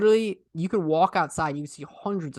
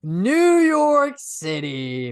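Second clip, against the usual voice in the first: very slow, louder, stretched words; grand drawn-out announcement; 2 semitones higher, 6 dB louder, much slower than usual. Pretend. Asked about New York City, answering in a grand drawl. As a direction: savor each word like a slogan.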